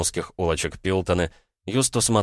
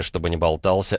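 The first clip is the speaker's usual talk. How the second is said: ordinary delivery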